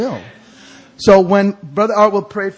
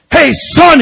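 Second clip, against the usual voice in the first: high, loud imitated shout; hammed-up holler; Brother Artwell; 11 semitones higher, 11 dB louder than usual. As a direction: shout it like a startled street preacher. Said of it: "He goes".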